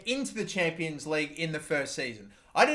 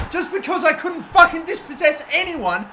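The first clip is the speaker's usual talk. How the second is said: loud, high-pitched furious rant; exasperated outburst at own defending; real frustration; 8 semitones higher, 7 dB louder than usual. Real, not acted. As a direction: vent the anger at the screen. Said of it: "Now I'm getting mad".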